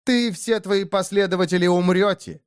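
high, forceful threat; spat-out threat. A narrator's reading. Деэмира threatens the orc and his followers, spat out. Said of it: "выплюнула Деэмира".

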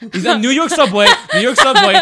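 high, loud laughing burst. Real, not acted. Recalling the subway video guy, laughing hard. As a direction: blurt it out through laughter.

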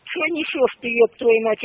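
usual speaking voice

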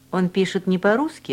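usual speaking voice

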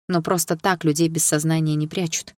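ordinary delivery

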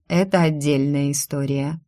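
plain reading voice

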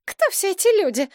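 high, hysterical demand; alarmed, high-strung outcry; frightened, hysterical. A narrator's reading. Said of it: "воскликнула она испуганным истерическим голосом".

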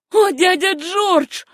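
high, slow, imploring cry; tearful exclamation. A narrator's reading. Niece appeals to her pitiless uncle, high tearful cry. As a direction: cry out high with a touch of sobbing. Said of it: "в голосе её послышались рыдания".

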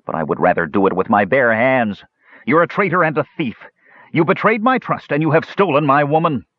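high, fast, furious accusation; rage. A narrator's reading. Kishpa accuses Tanis of theft, furious rising voice. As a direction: hurl the accusation at him. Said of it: "his face dark with rage".

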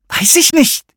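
hoch und laut angefaucht; fauchend. A narrator's reading. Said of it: "fauchte sie". (hohes, lautes Fauchen)

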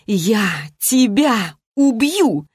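slow, each word set apart with pauses; girl threatens the mage, pausing between words; long pauses between words. A narrator's reading. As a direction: spit it out word by word. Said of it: "С продолжительными паузами между словами".

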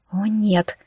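faint dismay; horrified. A narrator's reading. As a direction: let dread take the voice slightly. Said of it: "покачнулась от ужаса".

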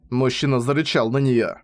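plain reading voice

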